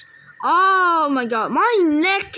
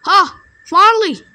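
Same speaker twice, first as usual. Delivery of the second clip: loud, higher burst of triumph; triumphant shout; 5 semitones higher, 8 dB louder than usual. Real, not acted. Gamer finally succeeding, loud high triumphant cry. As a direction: crow it loudly, relieved at last. Succeeds after long wait in game.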